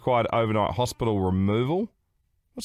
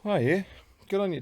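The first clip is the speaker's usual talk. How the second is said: ordinary talk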